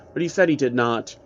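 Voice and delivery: ordinary delivery, nothing marked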